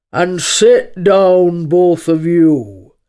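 very slow, loud lion command; ponderous, sleepy boom; weary lion. Acted. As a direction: order them lazily, tolling out each word. Lion as voiced figure.

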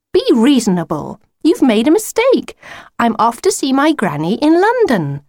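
high-pitched exasperated reasoning; cross. A narrator's reading. Lucy telling the wolf he is mistaken, cross. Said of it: "said Lucy crossly".

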